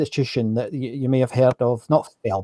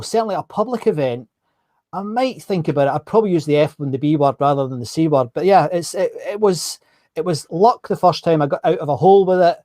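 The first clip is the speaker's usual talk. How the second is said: usual speaking voice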